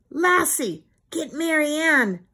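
high, urgent command to the dog; crying out. A narrator's reading. Timmy sends his dog after the doll, crying out. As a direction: pitch it up and cry out the order. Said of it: "cried Timmy".

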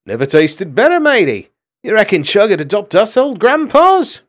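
a cheeky mouse teasing his friend; jokey sailor mouse. A narrator's reading. Gonf jokes.

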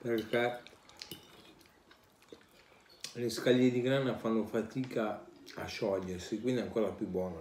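speaking between bites; talking while chewing. Real, not acted. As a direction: mouth partly full of pasta. Seen 1: Bite taken just before; fork at mouth, jaw working while talking.

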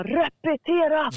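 mock drill-sergeant yell of "Repetera", drawn out; slow shouted "Repetera!" with a falling end; retold military shout. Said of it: "då bara skriker han".

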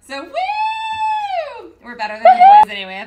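high, loud whooping cheer; excited cheer. Real, not acted. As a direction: hype up the room with a big woo. Hosts cheering the ladies' takeover.

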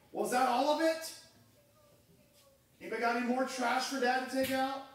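grumbling dad. Retold or imitated. Dad grumbling about taking trash.

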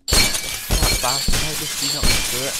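exasperated line with an Irish sound. Real, not acted. Gamer cursing at a game character, Irish-sounding. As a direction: let it come out sounding Irish. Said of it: "That sounded really Irish".

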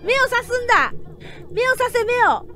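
shouting orders to stab the monster's eyes; urgent shout. Real, not acted. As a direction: yell at yourself to stab the eyes. Urging attack on monster's eyes.

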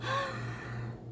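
high whispered gasp of mock shock. Artist hamming up French shock, a high breathy gasp. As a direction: gasp in pretend horror.

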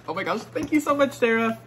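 high, fast, gushing thanks; excited squeal. Real, not acted. Vlogger gushing thanks to Sarah, high excited squeal.

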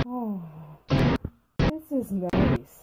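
very slow, contented drawl; relaxed sigh. Acted. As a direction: melt into the calm. Relaxing in lazy river.

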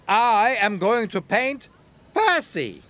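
lightly voiced proud artist; delighted artist. A narrator's reading. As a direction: savour the reveal. Artist reveals his subject.

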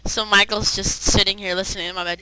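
ordinary delivery

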